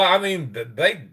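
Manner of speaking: ordinary talk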